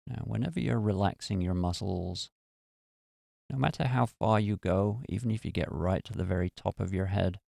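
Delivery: calm, even meditation voice with long pauses; guided meditation. Acted. Host leading listeners through a relaxation meditation, calm and unhurried.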